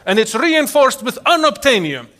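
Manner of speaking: high, loud, hammed-up bragging; imitating an over-confident homeowner; boastful homeowner. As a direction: boast about an impregnable house, mock-proud. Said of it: "can you imagine somebody telling you".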